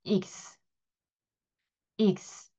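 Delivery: 익스 said ix the French way, drawn out; 익스 as the French letter name. Shown as a demonstration. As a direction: model the letter as 익스. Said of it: "프랑스어에서 익스라고 읽습니다".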